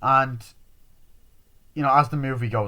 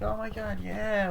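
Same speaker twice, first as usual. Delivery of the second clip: high, softer imitated voice; mock party-girl gush; 11 semitones higher, 3 dB quieter, slower than usual. Party girl with her gear on, mock gushing. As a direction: put on a high girlish gush.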